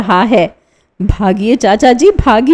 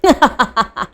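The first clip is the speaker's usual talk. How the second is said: madman's laugh; 4 dB quieter than usual. A narrator's reading. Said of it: "हंसी".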